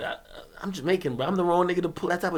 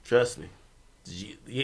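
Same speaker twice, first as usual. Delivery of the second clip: normal talking voice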